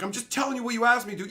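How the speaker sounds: fast, exasperated half-shout; exasperated retort. Retold or imitated. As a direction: throw it back at her, fed up and rushing. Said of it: "and I'm like".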